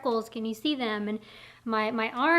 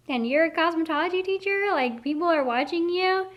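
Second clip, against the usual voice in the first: mock disbelief; 5 semitones higher than usual. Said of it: "I'm like".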